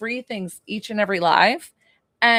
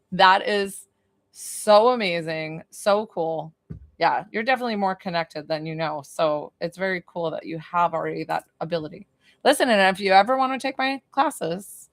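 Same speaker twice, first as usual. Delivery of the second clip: ordinary talk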